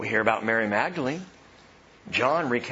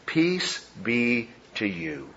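gentle greeting; 3 semitones lower, much slower than usual. Acted. Jesus' greeting, slow and soft.

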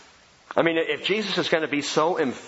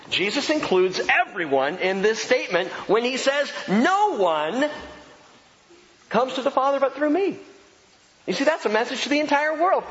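full-force preaching; 9 semitones higher than usual. Acted. Pastor preaching to the congregation, high and forceful. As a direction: preach it out to the whole room.

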